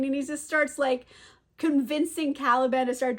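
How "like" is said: usual speaking voice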